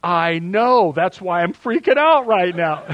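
loud, high, laughing reply; laughing son. Retold or imitated. Pastor quoting his son's laughing reply, loud and high. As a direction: blurt it out through laughter. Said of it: "his response was classic".

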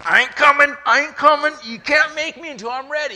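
put-on high, loud, defiant child voice; stubborn baby. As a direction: shout it like a stubborn baby refusing to be born. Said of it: "he was in there going".

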